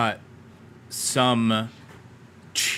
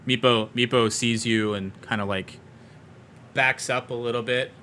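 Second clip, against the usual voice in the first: ordinary delivery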